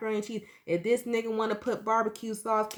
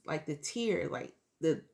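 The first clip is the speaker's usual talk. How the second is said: ordinary delivery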